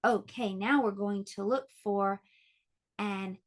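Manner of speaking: ordinary talk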